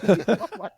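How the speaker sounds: real laughter; loud laugh at the punchline. Real, not acted. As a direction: laugh hard at your own punchline.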